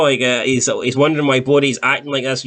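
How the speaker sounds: ordinary talk, nothing marked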